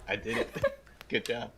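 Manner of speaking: quietly pleased with yourself, a laugh in it; soft, self-congratulating murmur through a chuckle; smug, proud. Acted. Player leaning back proud on the counter, smug. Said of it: "I'll smugly lean back, proud".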